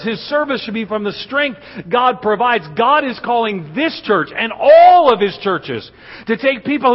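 high, loud, driving sermon climax; preaching at full force. Acted. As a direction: preach it to the rafters as the crowd rises.

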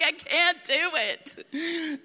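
high, drawn-out mock wail; overwhelmed mom. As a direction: imitate an overwhelmed mom wailing slowly. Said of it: "that's honestly how I felt".

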